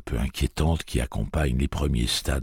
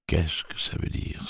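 hushed whisper to himself; whispered wonder; 5 dB quieter than usual. Acted. Jake puzzling over the strange prayer, whispered. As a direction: breathe the question, unvoiced.

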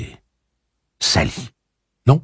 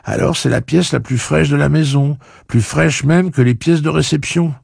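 character voice; 10 semitones higher, 5 dB louder than usual. Acted. Character dialogue in story.

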